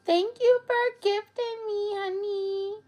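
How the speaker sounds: overjoyed surprise at a gift; delighted gasp. Real, not acted. Streamer thanking a viewer for a gift, gasping with delight.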